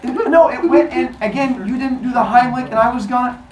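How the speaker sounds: high flustered excuse. Retold or imitated. Kronos excuses.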